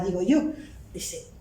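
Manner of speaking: ordinary talk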